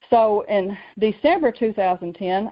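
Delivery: ordinary delivery, nothing marked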